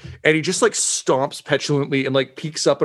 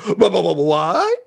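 stammering shock; 6 semitones higher, 4 dB louder, slower than usual. Acted. NPC stunned by news.

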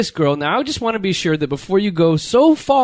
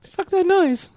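high falsetto, put-on female voice; squeaky mock-female retort; imitating woman's catchphrase; 14 semitones higher than usual. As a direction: pitch it way up like a mock girl. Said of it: "that's all she says".

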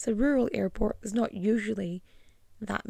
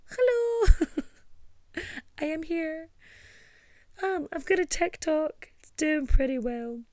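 awkward high greeting; 6 semitones higher than usual. Retold or imitated. Mock awkward self at event.

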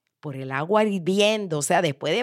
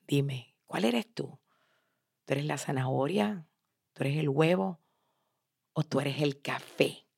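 hushed, intimate questioning; quiet, reflective asking with pauses; soft probing question; 3 semitones lower, 7 dB quieter than usual. Real, not acted. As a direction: drop right down and gently press the listener. Softly repeats question to listener.

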